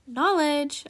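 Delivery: calling out. A narrator's reading. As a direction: call after someone to get their attention. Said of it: "a voice called".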